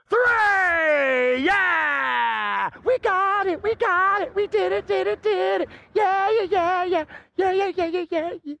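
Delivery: high, whooping victory cheer; giddy, high-pitched chanting; excited celebration. Acted. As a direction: celebrate like you just won it all. Celebrating the fire trick working.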